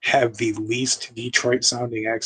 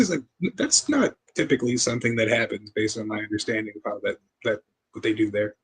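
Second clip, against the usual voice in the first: ordinary talk, nothing marked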